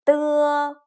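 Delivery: 的 held high and level; 的 in the flat high first tone. Shown as a demonstration. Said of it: "some tones last longer than the others".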